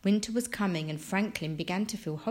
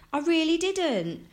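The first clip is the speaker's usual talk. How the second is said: high, louder insistent denial; protesting cry; 10 semitones higher, 5 dB louder than usual. A narrator's reading. Franklin insisting to his parents, protesting cry. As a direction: plead innocence in a high cry. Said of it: "Franklin cried".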